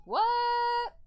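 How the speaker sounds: high, loud, drawn-out what; incredulous surprise. Real, not acted. Friend reacting mid-chat, loud high-pitched incredulity.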